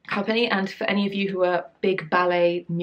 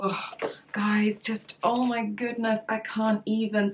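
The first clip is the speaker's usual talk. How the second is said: awed hush; 3 semitones higher, 3 dB quieter, slower than usual. Real, not acted. Overwhelmed by beautiful costumes.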